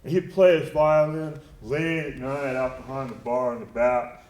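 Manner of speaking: usual speaking voice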